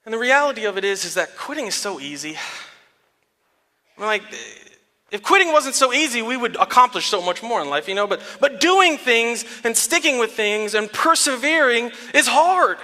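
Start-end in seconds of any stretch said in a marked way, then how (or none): none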